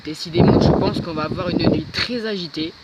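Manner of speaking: voix forte pour couvrir les rafales; parler fort par-dessus le vent. Real, not acted. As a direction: pousser la voix contre le vent. Filming outside in strong wind. (parler très fort dans le vent)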